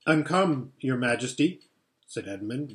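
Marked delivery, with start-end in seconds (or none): none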